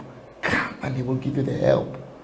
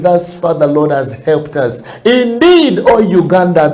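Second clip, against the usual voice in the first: loud, high, fervent preaching; preaching at full force; 4 semitones higher, 9 dB louder than usual. Real, not acted. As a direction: lift the congregation with a booming call. Loud closing exhortation to dance.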